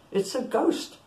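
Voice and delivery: high-pitched, restrained cry of fear; fearful cry. A narrator's reading. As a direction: read it as a frightened exclamation, without shouting. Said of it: "cried out in fear".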